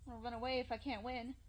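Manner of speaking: rising into a desperate shout; panicked. Acted. Panicked, cornered, deciding to fight.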